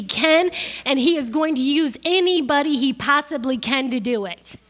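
loud, driving sermon delivery; preaching at full force. Acted. Preacher warning the congregation about the adversary, loud and forceful. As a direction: preach it hard against the adversary.

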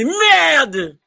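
high, loud mock heckle; high mock shout of Merde; heckler shout. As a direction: shout it like an angry French crowd.

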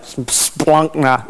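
splunkna said slow and thick, like guts; gutsy Greek sound. Shown as a demonstration. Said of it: "it sounds like guts".

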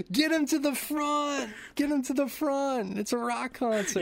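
high-pitched hammed-up shout to the front; mock crowd yelling. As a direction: yell it like a rowdy concertgoer. Acted goblins shouting at concert.

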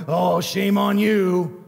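slow, drawn-out mock reproach; a hammed-up tut-tut of shame; mock scolding. Mock scolding of wayward brother.

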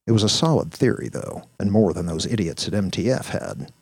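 ordinary delivery, nothing marked

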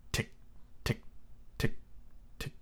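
small, clicky, evenly spaced ticks; crisp little ticking noise; tiny clock ticking. A narrator's reading. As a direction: tick like a faint clock, leaving gaps. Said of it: "such a tiny sound".

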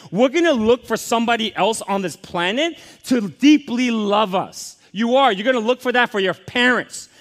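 loud, rising pulpit preaching; fervent sermon climax; preaching at full force. Acted. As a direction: drive it home to the congregation.

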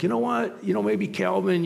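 a hint of a tentative cartoon friend; Hobbes's suggestion. Hobbes advising Calvin on resolutions, lightly acted suggestion. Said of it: "it's like Hobbes has gone like".

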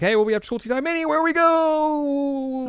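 very high, loud, held ending; acted cheer. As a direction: proclaim it like a gleeful rallying cry. Acted cheerful declaration by character.

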